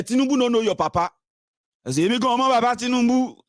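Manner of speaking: high-pitched indignant taunting; mock heated yelling; mimicked angry outburst. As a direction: parrot an outraged supporter. Mimicking angry defenders shouting.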